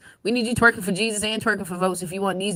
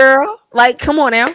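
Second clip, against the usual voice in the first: teasing call; 4 semitones higher, 9 dB louder than usual. Real, not acted. Teasing Kamala after a crude question.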